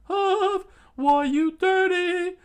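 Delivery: loud falsetto huff; hammed-up high protest; mock indignant outburst. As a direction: squawk in outrage, very high. Hurt fighter retorts.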